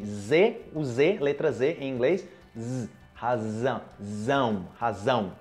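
"razão" with a clear buzzing z; voiced Z. Shown as a demonstration. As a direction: lean on the z of "razão". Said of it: "lembrar da pronúncia do inglês".

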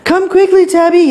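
high, loud call for help; panicked yell. A narrator's reading. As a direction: yell urgently in storybook fright. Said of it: "yelled Stanley".

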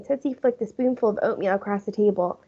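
usual speaking voice

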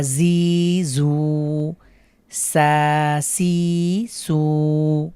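each syllable's vowel stretched long and slow; drawn-out recitation. Shown as a demonstration. Teacher shows students how to stretch the vowels. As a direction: chant the syllables, holding every vowel one alif. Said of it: "বানান ছাড়াই পড়ার চেষ্টা করব".

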